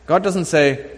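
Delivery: normal talking voice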